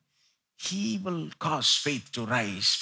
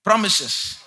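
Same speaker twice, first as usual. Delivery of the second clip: call and response; 4 semitones higher, 7 dB louder, slower than usual. Shown as a demonstration. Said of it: "Everybody say".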